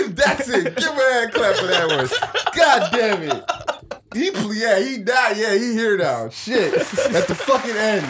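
talking through high laughter; words riding on laughs; laughing. Real, not acted. Laughing hard at joke.

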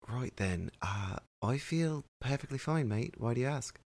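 put-on British accent that wanders toward Irish or Australian; attempted British accent. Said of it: "That was an attempt at British".

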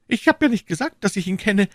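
high, despairing cry; desperate protest. A narrator's reading. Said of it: "rief Bonacieux in Verzweiflung".

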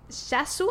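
"Shasu" with a soft sh, rising; decided pronunciation. Shown as a demonstration. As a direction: say "Shasu" as sha-su, ending up. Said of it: "así lo voy a pronunciar". ("Shasu" con sh suave y final ascendente)

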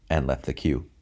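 ordinary delivery

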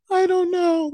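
very high, wailing helplessness; mock tearful. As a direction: squeal it as if too upset to answer. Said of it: "I just had no words".

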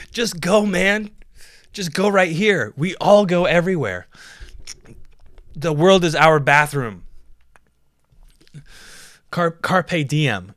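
loud, high fish proclamation; acted fish voice. Fish urging friend to go anywhere, loud proclaiming. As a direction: call it out grandly as the carefree fish. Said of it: "The other fish is like".

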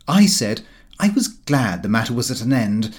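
ordinary delivery

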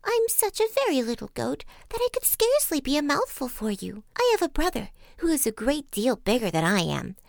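pleading small-goat voice, often high; frightened little goat. A narrator's reading. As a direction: talk the troll out of it as a little goat. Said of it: "he was very much frightened".

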